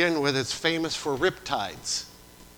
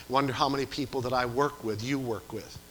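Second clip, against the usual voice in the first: usual speaking voice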